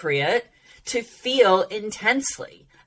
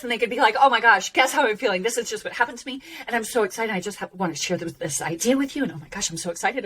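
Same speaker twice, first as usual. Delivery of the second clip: excited demo; 3 semitones higher, much faster than usual. Shown as a demonstration. Coach showing how a thrilled person talks, gushing and excited. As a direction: bubble over as if bursting to share news. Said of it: "Or they could talk like this".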